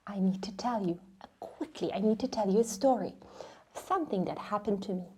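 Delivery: quick confiding whisper. Acted. Mom whispering a secret to the kids, quick and hushed. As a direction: lean in and share a secret, hurried.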